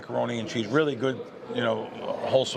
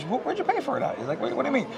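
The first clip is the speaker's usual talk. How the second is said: puzzled manager; 12 semitones higher, faster than usual. Retold or imitated. Manager questions him.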